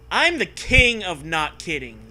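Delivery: usual speaking voice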